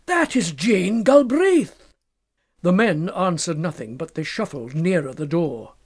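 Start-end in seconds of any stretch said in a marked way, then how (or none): 0.0-1.9 slow, loud and higher with horror; shocked cry. Acted. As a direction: cry out the name in disbelief. Fettes recognizes the dead girl.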